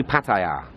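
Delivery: PATTAYA as pat-eye-YAH, long open final syllable; pronunciation one. Shown as a demonstration. Vlogger repeating the preferred way, Pattaya as pat-eye-YAH. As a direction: say Pattaya with TIE in the middle and a drawn-out YAH. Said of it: "this is pronunciation one".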